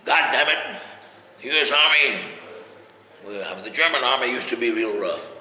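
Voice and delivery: loud, tough three-star army general; hammed-up hard-nosed general, loudly griping; General East Lesland. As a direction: bellow like a disgusted military commander. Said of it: "real tough".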